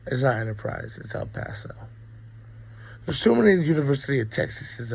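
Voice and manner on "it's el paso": whispered correction under the breath; hushed self-correction; whispered aside. Real, not acted.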